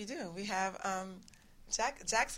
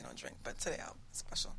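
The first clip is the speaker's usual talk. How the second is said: whisper; 5 semitones lower, 25 dB quieter than usual. Real, not acted. Confides she rarely drinks.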